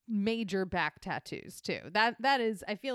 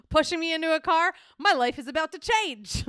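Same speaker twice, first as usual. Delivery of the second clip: mildly hammed-up amazement, higher; lightly voiced smitten man; 6 semitones higher, 4 dB louder than usual. The man picked up by a celebrity, lightly hammed-up awe. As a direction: marvel at a sudden change of fortune. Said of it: "he's like".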